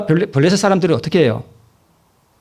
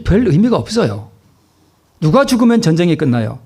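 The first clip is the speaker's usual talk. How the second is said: ordinary talk, nothing marked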